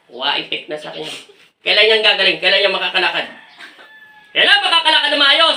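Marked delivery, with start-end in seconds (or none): 4.3-5.6 high-pitched pressing question; insistent demand. Real, not acted. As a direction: push for an answer, pitch raised. Presses spirit on when she walks.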